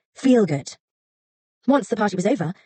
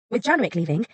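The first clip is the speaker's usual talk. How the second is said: ordinary delivery, nothing marked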